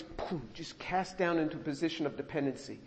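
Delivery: ordinary talk, nothing marked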